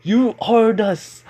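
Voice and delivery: high, loud outburst cut short; shout. Acted. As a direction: blurt it out at a shout, then break off. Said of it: "Kiba was about to shout".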